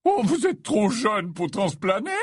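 hearty, genial teacher in a high put-on voice; cordial Slughorn. Slughorn greets his three potions pupils, cordial high voice. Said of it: "Slughorn d'une voix cordiale".

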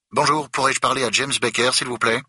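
brusque stranger getting to the point; abrupt caller. A narrator's reading. Museum director asks for Becker, abrupt and high. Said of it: "demande une voix abrupte".

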